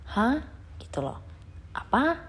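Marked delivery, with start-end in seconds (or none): 0.1-0.4 "Hah" swept upward as a question; rising tone. Shown as a demonstration. Teacher likens the tone to Indonesian, rising "Hah". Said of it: "nadanya naik". 1.8-2.2 "Apa" lifted high as a question; a questioning upward "Apa"; rising tone. Shown as a demonstration. Teacher likens the tone to Indonesian, rising "Apa". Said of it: "nadanya naik".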